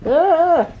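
loud high yelp; startled shriek. Real, not acted. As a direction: yelp in surprise.

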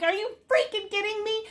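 high, put-on mock outrage; imitating her outrage. Seller replaying her own reaction to the sellout, mock outraged. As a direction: replay your disbelief in a silly voice. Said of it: "I'm like".

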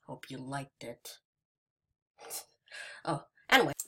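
usual speaking voice

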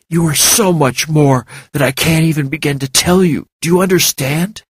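urging her to believe him, rising to a near shout; intense, earnest plea. A narrator's reading. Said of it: "with an intense look".

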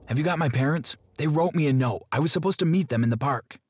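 put-on a captive boy begging his kidnapper for answers; anxious boy. Boy confronts kidnappers about note.

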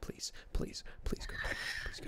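breathy whispered plea to go to bed; whispered begging. Real, not acted. Gamer begging friends to sleep, whispered. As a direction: whisper it, pleading. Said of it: "begging whisper to sleep".

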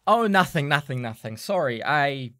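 loud, high flustered "nothing"; flustered backpedal. Acted. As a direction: cover yourself quickly, caught out. Bailey caught, backpedaling.

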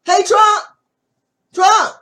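hollering for Trump; calling out. Pretend. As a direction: yell his name to get attention.

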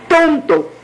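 high, quick falling jeer; taunting bird. A narrator's reading. Bird turning to the escaping boy, taunting. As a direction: toss out a high-pitched insult.

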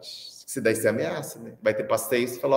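normal talking voice

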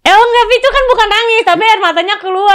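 high, loud, insistent objection; animated, high-pitched playful arguing; laughing protest. Real, not acted. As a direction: push back loudly through a laugh. Laughing protest in a teasing argument.

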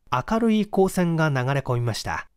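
usual speaking voice

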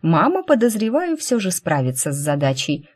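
ordinary delivery

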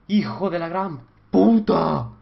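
slow, loud stretched curse; drawn-out furious curse. Real, not acted. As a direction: drag out the insult in anger. Repeat, louder and slow.

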